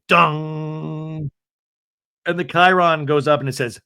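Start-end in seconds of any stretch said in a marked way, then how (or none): 0.1-1.3 long held "dong" like a gong; imitated intense music. Mimicking the mid-season trailer's music, held and ominous. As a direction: intone a dramatic trailer sting. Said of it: "we have this intense music".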